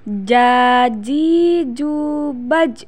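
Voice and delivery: very slow syllable-by-syllable drill; slow chanted model for pupils; drawn-out demonstration. Shown as a demonstration. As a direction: stretch every vowel evenly.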